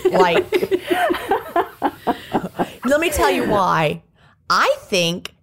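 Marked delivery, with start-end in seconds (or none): 0.5-2.8 high, bubbling laugh; real high-pitched laughing fit; laughter. Real, not acted. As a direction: burst out laughing at your own epiphany. Reacts to own huge epiphany.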